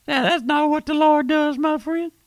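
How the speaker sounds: very high, sustained preacher's cry; high-pitched rebuke. Real, not acted. Preacher rebukes the man's self-importance.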